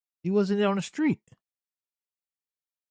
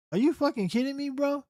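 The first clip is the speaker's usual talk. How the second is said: high, stunned disbelief; can't believe what he's hearing; are you serious; incredulous; 9 semitones higher than usual. Real, not acted. Disbelief at cop's claims.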